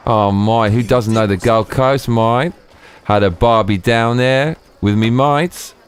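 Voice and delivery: slow, drawn-out Australian mate-talk; jokey Australian accent, vowels dragged; mock Aussie accent. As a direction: stretch it out in a playful Aussie accent. Plays an Aussie after Gold Coast question.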